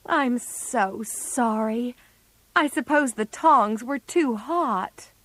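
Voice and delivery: very slow, regretful apology; apologetic. A narrator's reading. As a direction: say sorry slowly and sadly. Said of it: "said Joe unhappily".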